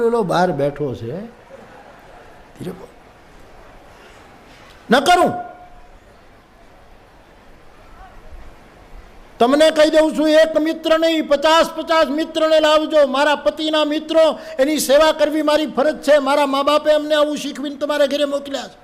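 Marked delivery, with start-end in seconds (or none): 1.2-2.9 hushed, breathless whisper. Retold or imitated. Husband hushing his wife, guest sitting outside, whispering. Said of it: "તું ધીરે બોલ". 5.0-18.8 angry wife. Retold or imitated. Wife scolding husband.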